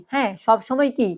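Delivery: ordinary talk, nothing marked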